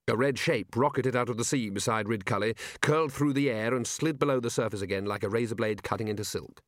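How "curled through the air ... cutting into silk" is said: usual speaking voice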